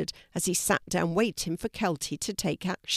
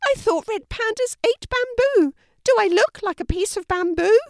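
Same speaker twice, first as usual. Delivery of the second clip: very high, louder squeaky fish; indignant fish; 6 dB louder than usual. Acted. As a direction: argue back loudly as a tiny high-voiced fish. Fish argues with Kelty.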